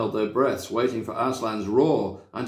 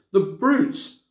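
high, tearful cry of The brutes; a girl crying out through tears; tearful outrage; 9 semitones higher, 3 dB louder than usual. A narrator's reading. Lucy watching Aslan mocked, high and tearful. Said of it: "tears streaming down her cheeks".

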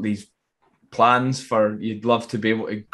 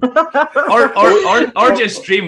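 loud, high, laughing stammer; real laughing; 13 semitones higher, 10 dB louder, much slower than usual. Real, not acted. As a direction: break into the words through a big laugh. Laughs off refusal of business plan.

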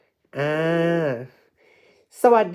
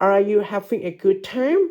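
"Are you having a good time?" rising at "time"; rising question tune; much slower than usual. Shown as a demonstration. Teacher repeats the question for pupils, rising tune on "time". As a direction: end on an upward lift at "time". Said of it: "ให้ออกเสียงสูงลูก".

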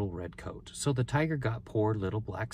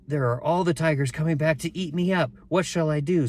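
higher-pitched storybook worry; worried; 6 semitones higher, 3 dB louder than usual. A narrator's reading. Boy seeing tigers come back, high worried voice. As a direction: fret as the frightened boy, pitched up.

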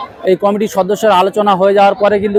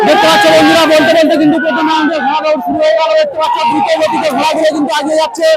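excited race commentary; 8 semitones higher than usual. Real, not acted. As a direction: shout it as the horses break. Race starts, live commentary.

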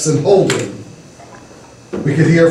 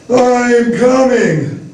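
long-drawn high wail; drowsy, drawn-out call; slow, sleepy wail; 7 semitones higher, much slower than usual. A narrator's reading. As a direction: wail it groggily, stretching each word. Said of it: "he wailed in a slow, sleepy voice".

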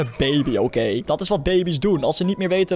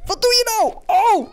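frantic scolding; 14 semitones higher, slower than usual. Real, not acted. Scolding baby with silly string.

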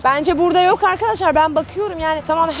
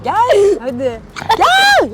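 high, loud calling shout; reenacted shout; 4 semitones higher, 4 dB louder, much slower than usual. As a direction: replay the yell at the dog. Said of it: "İşte aynen böyle oldu".

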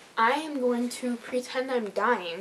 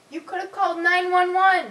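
indignant yelling; 6 semitones higher, 6 dB louder than usual. Acted. Scolding dog for not helping.

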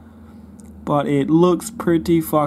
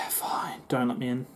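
sulky unvoiced whisper, much softer; 7 semitones lower, 12 dB quieter than usual. Real, not acted. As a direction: breathe it out in a sulk, no voice. Sulking at locked game door.

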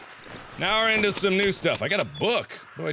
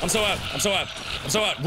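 ordinary talk

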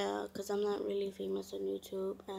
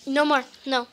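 much louder firm "No more"; sudden shout; 2 semitones higher, 11 dB louder than usual. Real, not acted. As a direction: snap it out loud. Shouting at the dog.

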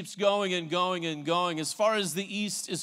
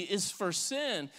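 usual speaking voice

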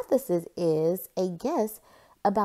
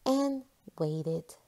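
ordinary delivery

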